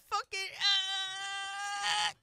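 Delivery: high powering-up battle yell; hammed-up anime power-up cry; Super Saiyan scream. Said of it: "I'm gonna go fucking Super Saiyan".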